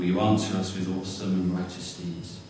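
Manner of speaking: ordinary delivery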